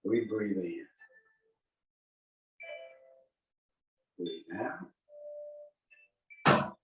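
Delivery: soft, unhurried movement cues; serene tai chi cues. Shown as a demonstration. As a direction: calmly talk them through the form. Leads a tai chi form.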